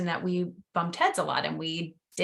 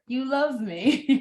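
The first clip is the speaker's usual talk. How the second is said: slow, higher sing-song 'you love me'; playful gloating; 6 semitones higher, 3 dB louder, much slower than usual. Pretend. Host playfully thanking the universe for unexpected money, gloating. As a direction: stretch it out, teasing and delighted. Host tries the game's phrase.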